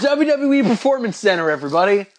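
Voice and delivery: high hammed-up ring announcer. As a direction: belt it out like an arena host. Mock announcer callout.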